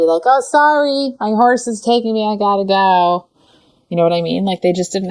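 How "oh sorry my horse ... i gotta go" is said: high, breezy fake apology, slowing at the end; mock brush-off apology. Rider dismissing someone on the way out, mock breezy. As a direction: toss off a careless sorry while leaving. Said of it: "half A S S apology".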